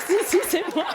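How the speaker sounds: high, loud insistence with a laugh; insistent. Pretend. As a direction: hammer it home, laughing. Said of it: "de lui dire".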